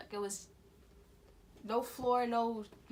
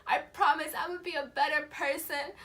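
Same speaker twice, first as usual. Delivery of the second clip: tearful, raised-pitch pleading; desperate praying; 6 semitones higher, 6 dB louder than usual. Retold or imitated. Dreamer promising God to be better, tearful pleading. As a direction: beg loudly, acting near tears. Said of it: "praying, and I was just like".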